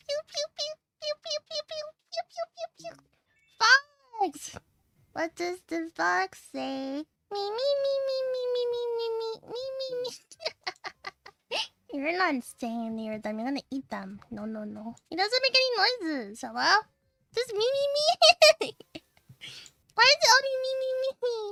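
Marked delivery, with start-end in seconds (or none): 0.1-2.9 very high, squeaky pew sounds; high squeaky pew noises. Acted. 7.3-10.1 high bleating me me me; high goat bleat. Acted. 17.3-21.4 high whiny. Acted. Complaining goat noise.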